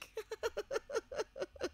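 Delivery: soft, high chuckle; quiet run of giggles; light chuckle. Real, not acted. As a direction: chuckle lightly at your own joke. Laughing at own singing idea.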